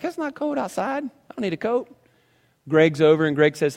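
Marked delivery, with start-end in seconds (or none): 0.0-1.9 put-on higher, softer bratty kid retort; mimicking son. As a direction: toss it back like a dismissive kid. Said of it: "And he's like".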